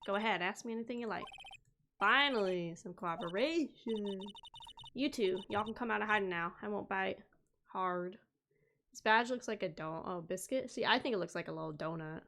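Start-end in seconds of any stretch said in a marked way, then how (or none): 0.1-8.2 put-on light Southern accent, bold and sassy. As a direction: play her as a feisty Southern gal. Voices Southern witness Lotta.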